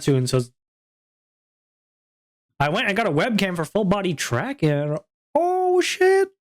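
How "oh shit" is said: high, loud surprised gasp. Real, not acted. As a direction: react with real shock. Surprised by the webcam news.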